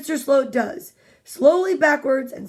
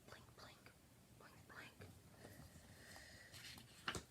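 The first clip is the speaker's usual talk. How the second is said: whispering; 37 dB quieter than usual. Pretend. Whispered unicorn blinking.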